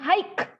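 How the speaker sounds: "hike" with a hard final k; wrong pronunciation. Shown as a demonstration. Teacher showing how some read high, wrong pronunciation. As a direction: hit the k at the end of "hike". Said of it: "pakai K".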